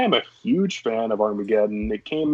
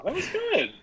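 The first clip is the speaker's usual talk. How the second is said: high teasing praise; 4 dB quieter than usual. Real, not acted. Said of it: "That was a good try".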